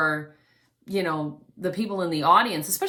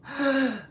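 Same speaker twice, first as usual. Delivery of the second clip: sharp, noisy gasp in; audible gasp; 6 semitones higher than usual. Shown as a demonstration. Voice teacher demonstrating a noisy breath, sharp gasp. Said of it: "Did you hear this?".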